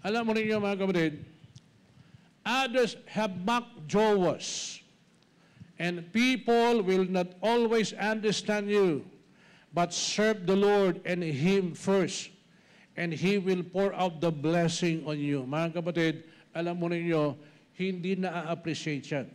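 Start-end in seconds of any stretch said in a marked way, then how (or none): none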